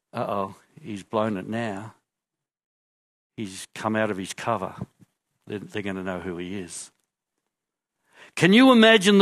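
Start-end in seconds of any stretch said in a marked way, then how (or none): 0.1-6.9 softer, worried murmur to the others; quiet aside. Acted. Disciple quietly warning the others, much softer and uneasy. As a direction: lean in and whisper-talk your worry. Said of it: "would have said quietly to the others".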